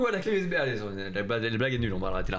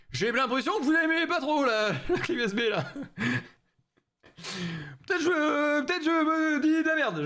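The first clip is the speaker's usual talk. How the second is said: mock offended; 9 semitones higher than usual. Teasing chat in put-on voice.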